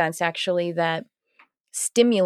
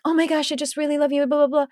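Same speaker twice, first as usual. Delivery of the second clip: high, fast fan chatter; mock gushing; 8 semitones higher, faster than usual. Podcast watchers meeting her, high quick mock gushing. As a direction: imitate a gushing admirer, rattled off high.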